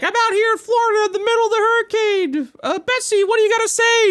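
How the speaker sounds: hammed-up reporter live from the hurricane; mock TV reporter. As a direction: ham up a news reporter in the storm.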